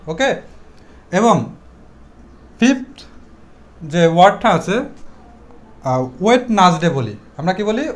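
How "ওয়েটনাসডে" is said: ওয়েডনেসডে in three syllables, "wet-nas-de"; wrong sound. Shown as a demonstration. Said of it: "This is wrong sound". (ওয়েডনেসডে-কে "ওয়েটনাসডে" বলা)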